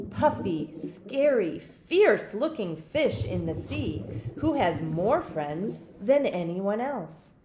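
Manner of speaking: plain reading voice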